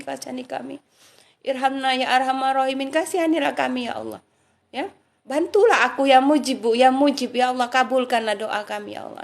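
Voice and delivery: rhythmic chanted invocation; prayerful dhikr. Shown as a demonstration. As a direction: pray it out as a heartfelt supplication. Leads sample dhikr and prayers.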